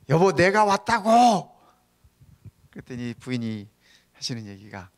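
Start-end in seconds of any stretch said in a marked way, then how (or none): 0.1-1.6 high, loud, drawn-out yell; shouting into ear. Retold or imitated. Husband shouts into his wife's ear, exasperated acted yell. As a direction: holler each word slowly, right at the listener. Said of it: "귀에다 대고".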